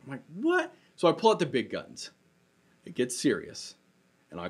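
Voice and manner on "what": much higher, startled mock disbelief; baffled what. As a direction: pitch it way up in comic confusion. Baffled dad retelling son's refusal.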